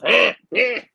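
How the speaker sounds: high, loud frantic grunting; Tasmanian devil grunts. Retold or imitated. Trainer grunting at students like a Tasmanian devil, high and loud. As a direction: sputter like a little Tasmanian devil. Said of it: "He's like a little Tasmanian devil".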